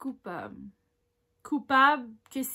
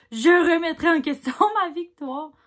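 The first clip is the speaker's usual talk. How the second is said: loud amused retake with a laugh; 4 semitones higher, 9 dB louder than usual. Shown as a demonstration. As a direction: repeat it louder, breaking into a laugh midway.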